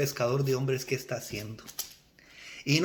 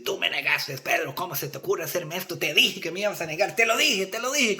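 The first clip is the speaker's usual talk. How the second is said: acted angry scolding. Jesus imagined angrily reproaching Peter for denying him, acted scolding. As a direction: build from rebuke to insistent I-told-you-so. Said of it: "en vez de venir bravo y decir".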